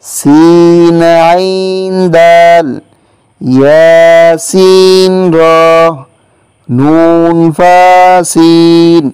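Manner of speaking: slow, measured spelling of Arabic letters; slow letter drill. Shown as a demonstration. Teacher spelling out three-letter compounds for students, slow and measured. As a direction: spell each letter out deliberately for students to repeat.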